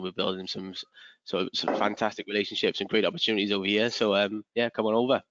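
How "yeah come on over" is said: ordinary talk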